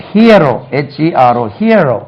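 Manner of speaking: ஹீரோ said "HEE-uh-ro", with a glide; correct hero. Shown as a demonstration. Teacher models the word for learners, glided pronunciation. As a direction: spell it, then say ஹீரோ as "hee-uh-ro". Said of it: "அது ஹீரோ இல்ல".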